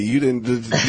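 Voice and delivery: ordinary delivery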